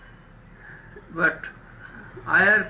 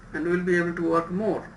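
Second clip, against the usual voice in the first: normal talking voice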